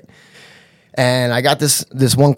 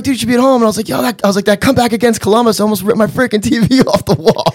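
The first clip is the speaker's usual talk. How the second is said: high-pitched excited gushing; freaking out; 10 semitones higher, faster than usual. Retold or imitated. Fan to hockey player about comeback, freaking out. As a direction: gush at your idol in a re-enacted fluster. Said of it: "I started freaking out".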